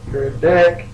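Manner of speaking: loud insult. Real, not acted. Player repeating an insult at the game master's villain, loud and high.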